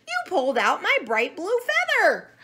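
loud, high accusing squawk; squawking chicken. A narrator's reading. Chicken Chuck accuses the monkey, squawking. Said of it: "squawked Chicken Chuck".

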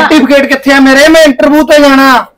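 snapping, frantic over lost papers; angry. Acted. Husband late for interview snaps at wife, angry. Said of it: "ਇੰਨੇ ਗੁੱਸੇ ਨਾਲ?".

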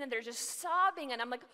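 usual speaking voice

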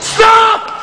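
sharp shouted stop. A narrator's reading. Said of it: "a voice behind him shouted".

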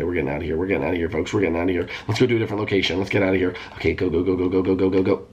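usual speaking voice

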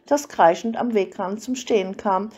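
ordinary delivery

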